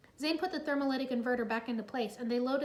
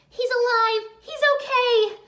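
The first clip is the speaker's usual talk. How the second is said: high, loud overjoyed cry; crying out with joy; 12 semitones higher, 13 dB louder, slower than usual. Acted. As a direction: burst out in relief. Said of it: "she cried".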